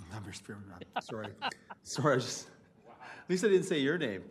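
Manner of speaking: real flustered giggle at his own slip; embarrassed laughter. Real, not acted. Embarrassed after misnaming the mayor.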